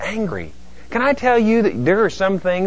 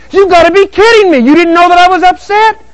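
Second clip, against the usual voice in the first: very high, loud, outraged; exasperated disbelief; 10 dB louder than usual. Acted. Upset spouse to oblivious partner, loud outraged disbelief. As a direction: shriek it at a clueless partner.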